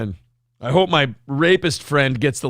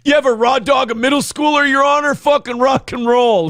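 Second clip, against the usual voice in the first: loud, high rowdy glee; hammed-up client whooping at the judge; cocky cheer; 8 semitones higher, 3 dB louder than usual. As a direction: cheer it like a frat boy. [name] blurting in court.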